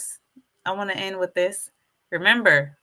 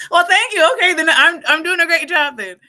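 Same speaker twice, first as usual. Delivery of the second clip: high, loud, voice catching with emotion; overwhelmed thanks, nearly crying; touched and emotional; 7 semitones higher, 9 dB louder than usual. Real, not acted. Vlogger thanking a viewer who called her channel a masterclass, choked up.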